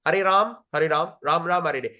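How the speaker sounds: Hare Ram run together, Ram without its final A; swallowed syllables. Shown as a demonstration. Teacher shows students what they miss, Ram cut short. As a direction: chant Ram as one beat, straight through. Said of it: "Have you really chanted the thirty-two syllable?".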